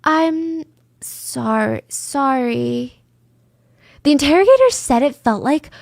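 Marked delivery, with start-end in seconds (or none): 0.0-3.0 practised apology. Retold or imitated. Suspect trying out apologies on interrogators, slow and rehearsed. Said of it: "practicing which version of".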